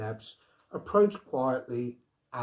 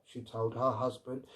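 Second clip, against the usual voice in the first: usual speaking voice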